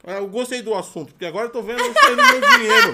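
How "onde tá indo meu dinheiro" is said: laughing. Real, not acted. Joking about money wasted.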